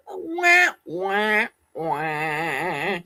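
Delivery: three falling wahs, last drawn out; playful descending "wah, wah, wah" let-down; mock sad-trombone wah. Streamer jokes that she never answered, mock sad-trombone wahs. Mimics a let-down sound effect.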